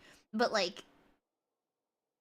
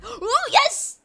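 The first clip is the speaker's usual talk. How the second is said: high, loud fangirl squeals; squealing with joy, much higher and louder; excited squeal; 10 semitones higher, 9 dB louder than usual. Real, not acted. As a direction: shriek with delight as he appears. Squealing as the character appears.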